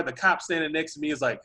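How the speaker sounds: ordinary delivery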